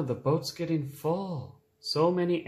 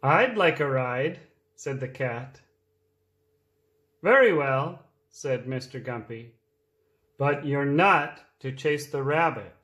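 reading a picture book aloud; lively read-aloud for small children; storytime voice; 2 semitones lower than usual. A narrator's reading.

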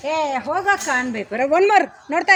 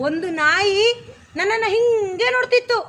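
lively line reading, a bit louder and slower; lively demo line; 5 semitones higher, 5 dB louder, slower than usual. Shown as a demonstration. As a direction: show the kid how to say it with energy. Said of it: "ಚೆನ್ನಾಗ್ ಮಾಡು". (ಉತ್ಸಾಹದಿಂದ ಜೋರಾಗಿ ಹೇಳುವುದು)

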